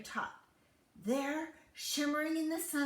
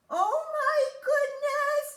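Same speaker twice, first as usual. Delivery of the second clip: very high, loud panicked cry; alarmed giantess; 10 dB louder than usual. Acted. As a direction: squeal in alarm. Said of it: "cried the giantess".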